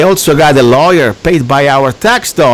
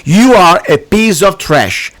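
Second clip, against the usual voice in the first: angry insult; 4 semitones higher, 5 dB louder, slower than usual. Real, not acted. Insulting the chancellor on air.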